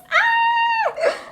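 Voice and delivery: high, loud squeal of joy; real excited scream; excited shriek. Real, not acted. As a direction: scream with pure delight. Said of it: "I'm getting too excited".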